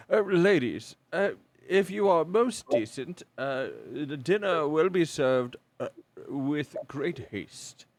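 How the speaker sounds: put-on loud, formal old butler. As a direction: speak like a stuffy old butler. GM voices older mustachioed servant.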